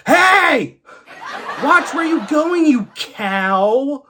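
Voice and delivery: loud, high mock-furious driver yell; road rage. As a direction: burst out yelling at another driver. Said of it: "and his road rage".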